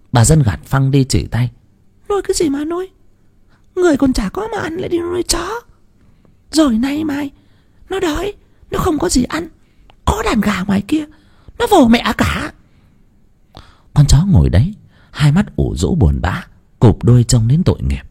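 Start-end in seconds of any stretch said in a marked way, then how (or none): 2.1-12.5 put-on high old woman snapping him down; sharp old wife. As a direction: brush him off, high and scornful. Bà vợ gạt phăng. (giọng bà già cao, gạt phăng)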